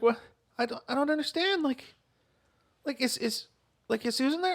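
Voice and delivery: put-on high quavering-free but faltering old lady voice; worried elderly woman. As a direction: stammer like a confused grandma. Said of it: "She's like".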